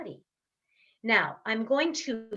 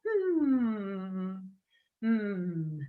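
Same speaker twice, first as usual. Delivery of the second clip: falling closed-lip hum in two breaths; long hum; 3 semitones lower, 4 dB quieter than usual. Shown as a demonstration. Coach reversing the circle, falling hums. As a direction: hum downward, then hum lower. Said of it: "with a hum".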